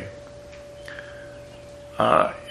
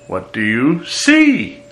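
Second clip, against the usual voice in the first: slow, higher and louder, SEE punched; stress on see; 4 semitones higher, 4 dB louder, slower than usual. Shown as a demonstration. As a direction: build up to a strong "see". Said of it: "Or it could be".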